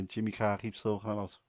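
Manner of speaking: usual speaking voice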